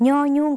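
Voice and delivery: teacher models "ย่อยุ่ง" in Lao, nyo nyung; Lao letter name. Shown as a demonstration. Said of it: "ภาษาลาวเรียกว่า".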